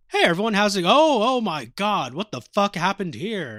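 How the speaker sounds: high, loud burst of exaggerated shock; mock freak-out. Hachi pops up and finds everyone dead, mock freak-out. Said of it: "he's freaking the fuck out".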